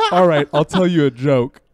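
chuckling joke setup; laughing while quoting. Retold or imitated. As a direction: quote through laughter, loud.

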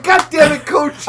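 high, loud mock cursing; exasperated yell. Player to Coach in the game, exasperated yell. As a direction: yell at a useless teammate. Acting out yelling at game character.

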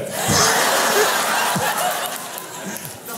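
very high squealing laugh. Real, not acted. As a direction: lose it laughing. Laughing hard.

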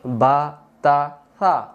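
drawn-out Arabic letter names ba, ta, sa; spelled out for learners; slow letter names. Shown as a demonstration. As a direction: say each letter slowly and clearly. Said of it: "আরবি অক্ষর কোনটা?".

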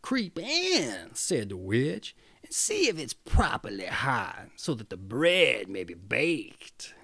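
whispering, scheming witch voice; sly witch. Acted. Witch coaxing Gretel into the oven, sly whisper. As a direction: lure her into the oven with a sly hush.

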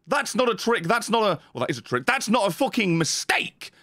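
normal talking voice

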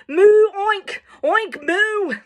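high, loud alternating moo and oink; happy animal calls. Acted. Cow and pig very happy, high moo-oink volley.

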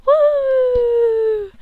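high, loud whoop; cheer. Pretend. Cheering Kieran from Devon, a loud whoop. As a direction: cheer like a crowd for him.

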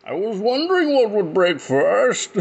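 high, loud strained villain voice; a bad Bane impression, pushed loud. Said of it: "I can't do that voice without laughing".

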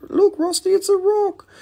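put-on very high, silly kid voice; childish mock voice. Teasing Rusty about the rock in a very high kid voice. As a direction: squeak it like a little kid.

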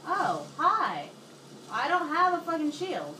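loud, high furious yelling; raging gamer. Real, not acted. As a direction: yell at the game in rage. Enemy attacking, no shield.

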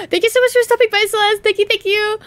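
thrilled to see a viewer arrive; excited greeting. Real, not acted. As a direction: gasp then rush the welcome. Streamer greets a new viewer.

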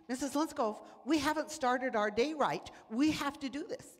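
a hint of a child's demand; insistent children. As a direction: plead like a class of eager kids. Children demanding their Bible story.

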